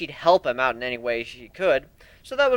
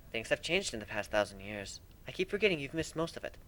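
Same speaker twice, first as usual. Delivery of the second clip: gentle elder sister; 2 semitones lower, 10 dB quieter than usual. A narrator's reading.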